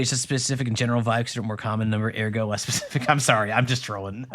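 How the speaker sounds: ordinary delivery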